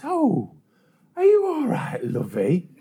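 unhurried lady. Retold or imitated. Woman checking on injured paperboy, in no hurry. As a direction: coo it with exaggerated unconcern. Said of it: "she's in no hurry whatsoever".

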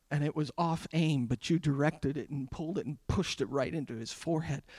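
low, hushed confession with long pauses; honest prayer. Acted. Preacher modelling a silent prayer to God, hushed and halting. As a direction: confide it softly to God. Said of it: "just think to him and say".

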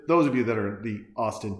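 normal talking voice

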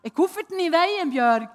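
loud, high calling out through the house; shouting her name. Retold or imitated. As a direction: call out to Bjørg across the hall. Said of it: "jeg ropte med høy røst".

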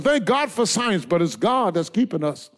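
ordinary talk, nothing marked

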